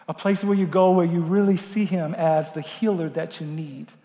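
ordinary talk, nothing marked

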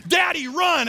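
high, loud battle yell; imitated kid's shout. Son charging dad with fresh squirt gun, excited shout. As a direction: shout like a little boy attacking. Son resumes the water fight.

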